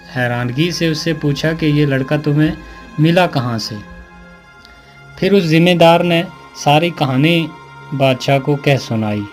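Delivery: ordinary delivery, nothing marked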